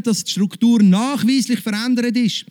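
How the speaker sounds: ordinary talk, nothing marked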